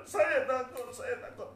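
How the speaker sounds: high, acted frightened cry; tearful fear. Bilal answering his worried family, tearful fear. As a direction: start loud and high, voice crying. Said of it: "saya menangis, saya takut".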